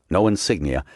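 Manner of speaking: plain reading voice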